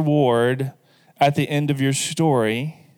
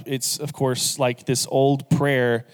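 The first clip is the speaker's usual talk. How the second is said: usual speaking voice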